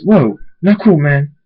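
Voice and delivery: loud, high startled yelp; startled shout at the spark; startled protest. A narrator's reading. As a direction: jump back from the jolt. Startled by lightning between them.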